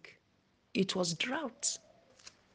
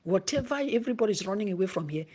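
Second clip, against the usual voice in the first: normal talking voice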